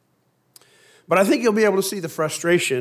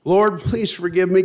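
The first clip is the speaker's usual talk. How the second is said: pleading cry; 6 semitones higher, 8 dB louder than usual. Acted. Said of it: "cried Noah".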